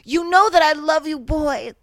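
loud, slow, hammed-up dramatic delivery; dramatic loud read. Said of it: "do a little dramatic read".

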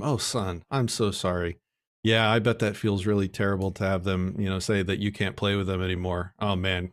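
low-pitched, sympathetic parent consoling a son; gently mirror the child's hurt back to him; empathizing. Acted. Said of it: "empathize, mirror back to him".

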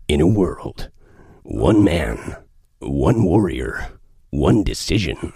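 deep, slow booming trailer announcer; the epic movie trailer narrator, low and loud. Retold or imitated. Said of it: "I'm stealing that idea from Pablo Francisco".

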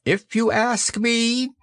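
high, drawn-out owl voice; measured, high owl. Acted. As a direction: begin an opinion slowly, pitched high. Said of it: "said Owl".

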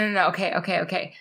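ordinary delivery

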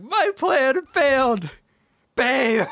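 high, loud, drawn-out wailing; hammed-up bellow of a foiled villain; mock villain despair. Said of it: "You said babe like that".

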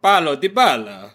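slow, high Argentinian accent; put-on Argentinian pronunciation. Shown as a demonstration. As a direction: draw it out like an Argentinian. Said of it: "Say it in an Argentinian accent".

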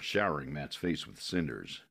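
ordinary delivery, nothing marked

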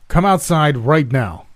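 high, loud, drawn-out command; slow, forceful yell; yelled order. A narrator's reading. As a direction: shout the order slowly toward the house. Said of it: "my dad yelled".

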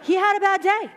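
high, loud "He had a bad day"; emphatic defense. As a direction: insist brightly. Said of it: "And I go".

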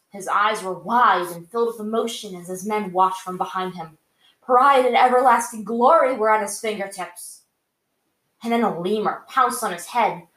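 dramatic fan fiction narration; story narration. A narrator's reading. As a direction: read the chapter out like an audiobook. Reads the fan fiction chapter.

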